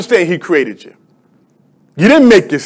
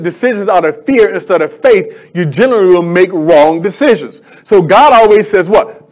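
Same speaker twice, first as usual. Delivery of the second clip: usual speaking voice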